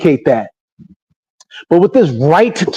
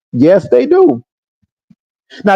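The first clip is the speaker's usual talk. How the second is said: ordinary talk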